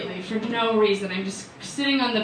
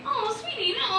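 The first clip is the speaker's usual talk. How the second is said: higher, soft, tender comfort; soothing doctor; 12 semitones higher, 5 dB quieter than usual. Retold or imitated. Doctor comforts the crying patient, soft sweet reassurance. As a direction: coo it gently like soothing a child. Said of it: "she's like".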